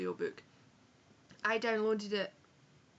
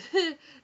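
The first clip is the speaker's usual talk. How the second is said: loud laugh; 9 semitones higher, 12 dB louder than usual. Real, not acted. Bursts out laughing mid-review.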